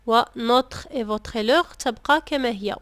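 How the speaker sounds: ordinary talk, nothing marked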